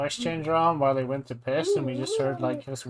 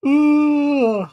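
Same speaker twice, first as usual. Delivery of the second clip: sudden loud ooh of surprise; startled shout; 11 semitones higher, 9 dB louder than usual. Real, not acted. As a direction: jump at a scare.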